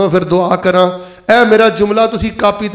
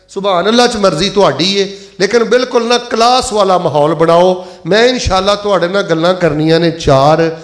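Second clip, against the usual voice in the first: normal talking voice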